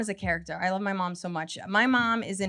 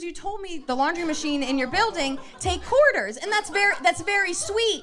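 mock mom; 8 semitones higher, 5 dB louder than usual. Mom voiced in story.

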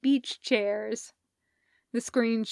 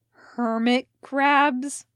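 growled; 3 semitones higher, 5 dB louder, much slower than usual. Acted. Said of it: "Elliot growled".

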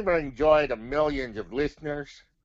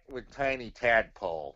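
ordinary talk, nothing marked

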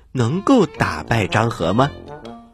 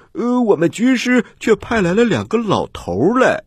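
mocking; 7 semitones higher than usual. Officers mock old generals.

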